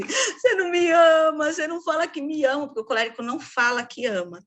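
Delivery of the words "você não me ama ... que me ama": tearful wife. Retold or imitated. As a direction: play the hurt wife on the verge of tears. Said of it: "a melancólica chega pro marido e fala assim".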